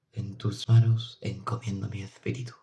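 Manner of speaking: soft, hushed dying prayer; murmured prayer. A narrator's reading. Priest praying softly at the mother's deathbed. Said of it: "rezó el santo varón".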